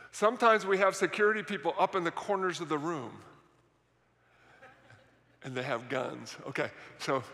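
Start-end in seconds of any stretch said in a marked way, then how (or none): 5.4-7.2 low, hushed disclosure; confidential aside. Real, not acted. Pastor tells the congregation about armed security, confidential aside. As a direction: let it slip softly under your breath.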